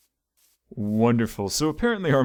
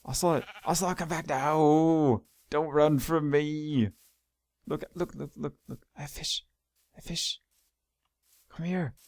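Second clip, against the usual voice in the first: coaxing a pet; 4 semitones higher than usual. Real, not acted. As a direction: lure the animal gently with a treat. Coaxing ocelot again.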